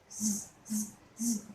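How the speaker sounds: low buzzing z; stormy buzz. Shown as a demonstration. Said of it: "say what I say".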